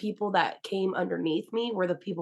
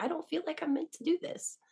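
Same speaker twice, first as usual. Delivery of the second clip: high, soft, near tears; a small high whimpering protest; tearful doubt; 10 semitones higher, 7 dB quieter than usual. Retold or imitated. Crying to her friend, a high soft whimper.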